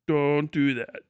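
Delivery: ordinary talk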